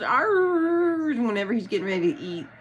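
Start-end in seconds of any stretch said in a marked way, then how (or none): 0.0-1.1 high barked arr; dog bark. Retold or imitated. Owner imitating her dog's bark. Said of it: "The only time he goes".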